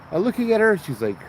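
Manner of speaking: usual speaking voice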